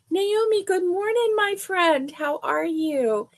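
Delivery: lifted high, sing-song hello; bright high greeting. Real, not acted. Host greeting a viewer by name, high and cheery.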